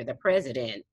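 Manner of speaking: ordinary talk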